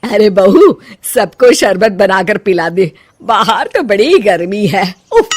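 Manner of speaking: put-on loud, commanding older woman calling out; mother-in-law bossing her son's wife in front of guests; bossy mother-in-law. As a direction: order the daughter-in-law about, loudly. Mother-in-law orders daughter-in-law.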